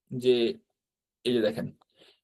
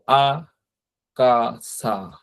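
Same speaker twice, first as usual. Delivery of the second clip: আকাসা broken slowly into আ, কা, সা; very slow, separated আ-কা-সা; syllable by syllable; much slower than usual. Shown as a demonstration. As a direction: draw out each syllable of আকাসা with gaps. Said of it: "অর্থাৎ সামনের যে এই অক্ষরগুলো".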